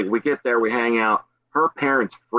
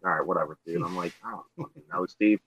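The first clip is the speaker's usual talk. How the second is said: mumbled indifference; 5 semitones lower, 9 dB quieter, faster than usual. Retold or imitated. Retelling his own bored reply.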